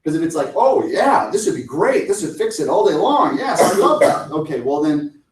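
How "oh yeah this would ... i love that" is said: higher, louder, laughing delight; imagined client raving about the solution; enthusiastic yes. Retold or imitated. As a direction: gush about the fix.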